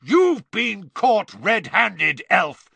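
high, loud, drawn-out roar; furious bellowed accusation. A narrator's reading. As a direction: roar it slowly at the culprit. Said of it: "Mister Diggory roared".

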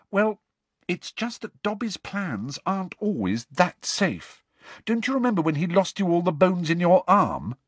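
high, earnest schoolgirl voice; a worried, sensible girl; Hermione voice. A narrator's reading.

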